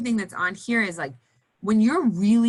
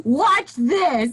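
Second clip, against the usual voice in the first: louder, higher mock threat; a hammed-up dare; defiant challenge; 6 semitones higher, 9 dB louder than usual. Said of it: "Yeah, exactly".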